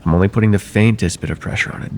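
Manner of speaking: ordinary talk, nothing marked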